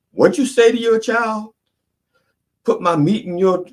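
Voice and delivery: high, outraged gasp of disbelief; shocked outsider. Retold or imitated. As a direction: squeal it like a scandalized bystander. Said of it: "somebody go like".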